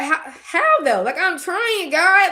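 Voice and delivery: high, rising plea to God; acted-out frustrated prayer; exasperated plea. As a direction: plead upward, loud on the last word. Acts out pleading with God.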